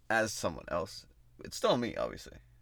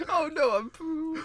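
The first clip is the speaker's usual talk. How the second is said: much higher, slow silly voice; slower than usual. Acted. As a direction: squeak it slowly like a cartoon. Copies the silly voice.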